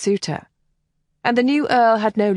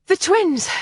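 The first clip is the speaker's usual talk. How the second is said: The twins! exclaimed high, falling hard on twins; The twins! burst out in relieved recognition; breathless exclamation; 12 semitones higher than usual. Acted. Kathleen reaching Devon's side, calling out The twins! high. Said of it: "Kathleen said breathlessly".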